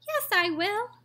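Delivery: very high tiny voice; squeak. A narrator's reading. Tiny boy answering his mother, squeaky. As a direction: squeak like a mouse. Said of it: "squeaked the tiny voice".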